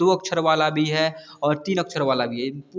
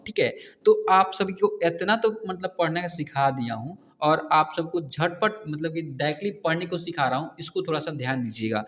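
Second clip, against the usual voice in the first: normal talking voice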